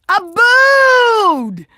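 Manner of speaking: loud, high shout of a name; happy yell across the room; joyful shout of greeting. Real, not acted. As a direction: call out a friend's name with glee.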